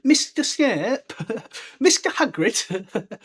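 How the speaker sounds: high, slow mock-polite pupil; mock formal address. Pupil addressing teachers, slow mock-polite. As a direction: draw out the names like a polite pupil. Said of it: "It sounds weird".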